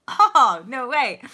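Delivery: hammed-up disbelief; mock surprise. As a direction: act amazed at the age. Reacting to stated age.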